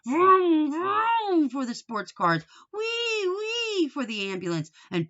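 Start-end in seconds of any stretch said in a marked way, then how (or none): 0.0-1.5 high, loud vroom like a toy car; boy's mouth-made car engine; engine noise. A narrator's reading. Boy playing with toy cars, high vroom engine noise. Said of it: "making noise with his mouth". 2.7-3.9 high wee like an ambulance siren; siren noise. A narrator's reading. Boy playing ambulance, high siren wee. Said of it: "making noise with his mouth".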